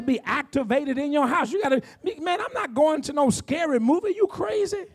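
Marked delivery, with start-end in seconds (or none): 1.5-4.8 incredulous refusal. Acted. Preacher refusing to watch a scary movie, incredulous refusal. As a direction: pitch climbing into high-voiced disbelief.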